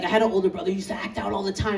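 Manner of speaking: ordinary talk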